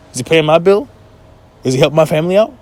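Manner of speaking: ordinary talk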